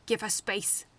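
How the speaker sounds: loud protective shout; yelled. A narrator's reading. Snow guarding Saskia from bystanders, yelling. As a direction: bark them back. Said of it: "she yelled".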